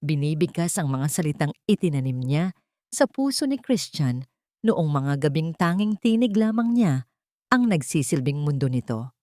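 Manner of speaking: ordinary delivery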